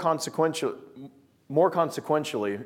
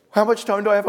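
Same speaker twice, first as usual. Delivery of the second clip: mock alarm; 7 semitones higher, 4 dB louder than usual. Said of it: "his eyes get real wide".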